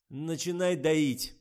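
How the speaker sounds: loud, slow, high order; command. A narrator's reading. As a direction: bark the order. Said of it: "приказал Николай".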